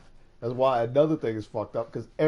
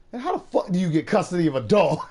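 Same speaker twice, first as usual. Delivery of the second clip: mock outrage; 7 semitones higher, 8 dB louder, faster than usual. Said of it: "He's like".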